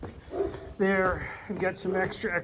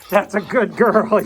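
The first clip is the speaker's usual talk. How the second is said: dog praise; 11 dB louder than usual. Real, not acted.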